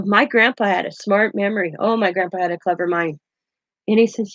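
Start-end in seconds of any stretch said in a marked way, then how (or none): none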